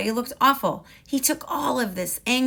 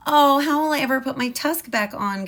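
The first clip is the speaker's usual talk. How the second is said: higher, louder mock wail; hammed-up storybook wail over a broken tusk; 6 semitones higher, 7 dB louder than usual. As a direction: Ganesha wailing about his tusk. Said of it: "Ganesha wailed".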